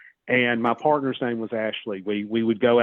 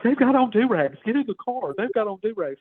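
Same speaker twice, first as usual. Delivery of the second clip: very high-pitched imitation of a scared partner; panicked mock yell; 10 semitones higher than usual. Scared partner urging him into the car, mock yelling. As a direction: mimic her panicked yelling, pitched way up without much volume. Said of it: "she starts yelling at me".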